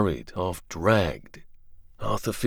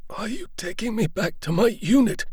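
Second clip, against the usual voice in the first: put-on higher, questioning young soldier; weak soldier asking the doctor; wounded soldier; 13 semitones higher than usual. Arthur asks about return.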